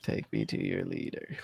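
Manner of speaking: very low, quiet alien voice. Pretend. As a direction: drop deep and soft like a spaceman. Classic alien line.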